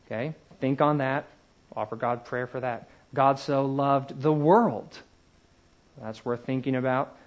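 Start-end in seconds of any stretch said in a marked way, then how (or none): 3.1-5.0 slow verse landing hard on "world"; stress on WORLD. Shown as a demonstration. Teacher turning the verse over slowly, stress on WORLD. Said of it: "emphasize one word at a time".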